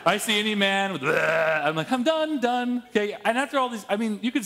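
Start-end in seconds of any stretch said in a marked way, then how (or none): 1.0-1.7 loud mock demon "bleh"; imitated demon scream. Imitating a possessed man running from the mountains, demon scream. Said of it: "screaming out of the mountains in a demon voice". 1.9-2.8 high, hammed-up bailing out; mock panic. Disciple sees screaming demon man, mock-panicked retreat. Acting a disciple fleeing in fright.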